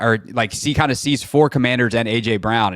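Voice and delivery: ordinary talk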